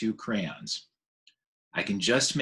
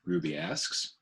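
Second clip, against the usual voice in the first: ordinary delivery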